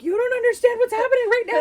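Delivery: high, fast, loud retold panic; tearful panic. Panicking wife to calm husband, high tearful protest. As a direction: wail it out in mock hysterics. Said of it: "And I started to cry".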